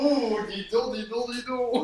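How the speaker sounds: high-pitched playful "well, well"; mock amazement. Co-host reacting to the hacked podcast intro, mock amazement.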